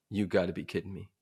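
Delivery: low-key, soft incredulous grumble; muted disbelief. Retold or imitated. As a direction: say it softly to yourself in disbelief. First, muted disbelief at the order.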